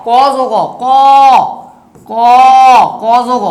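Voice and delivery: ক held out long, then broken into its parts; consonant sound demo. Shown as a demonstration. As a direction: draw out ক as a lone drilled letter, with pauses. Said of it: "তো এরকম এগুলো বলি ব্যঞ্জন বর্ণ". (ক টেনে টেনে আলাদা করে উচ্চারণ)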